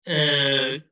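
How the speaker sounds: normal talking voice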